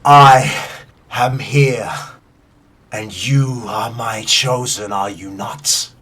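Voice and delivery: slow, ominous godlike proclamation; storm deity. Acted. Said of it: "She says".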